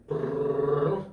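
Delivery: rolled "brrrr" of a printer; money printer noise. Retold or imitated. Co-host mimics the Federal Reserve printer, rolled whirring noise.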